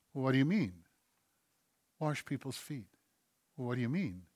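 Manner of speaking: ordinary talk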